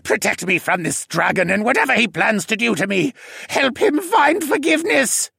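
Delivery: pleading prayer. A narrator's reading. Assassin prays for protection from the dragon, pleading. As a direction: pray desperately for rescue. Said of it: "pleaded and put his hands together in prayer".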